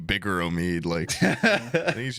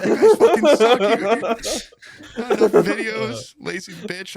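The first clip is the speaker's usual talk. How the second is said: mock hater; 8 semitones higher, 6 dB louder than usual. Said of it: "that guy fucking hates me".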